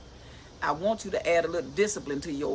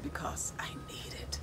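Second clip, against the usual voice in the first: whisper; 11 dB quieter than usual. Real, not acted. Quiet confession.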